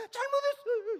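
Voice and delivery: high loud sobbing plea; crying apology. Retold or imitated. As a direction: wail the apology like a crying child. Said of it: "이러고 울어". (울면서 높게 외치는 아이의 사과)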